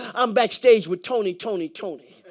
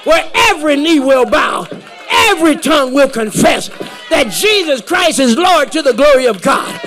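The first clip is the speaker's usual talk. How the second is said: loud, high, rousing sermon climax; full-force preaching; 5 semitones higher, 7 dB louder than usual. Real, not acted. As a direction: proclaim it to the whole congregation with building fervor. Preacher drives home his point.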